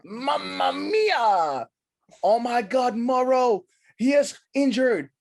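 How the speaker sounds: high, loud, slow wailing Italian commentator; Italian commentator wailing in mock horror; hammed-up Italian accent. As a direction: cry out in mock Italian panic over the injury. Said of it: "I can't do a good accent".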